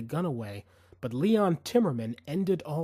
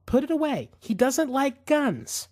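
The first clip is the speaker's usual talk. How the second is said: pleading; 11 semitones higher, 5 dB louder than usual. A narrator's reading. Woman begs gunman.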